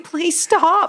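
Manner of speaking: high, pleading please stop; exasperated plea. Retold or imitated. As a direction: beg him to quit, half joking. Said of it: "I was like".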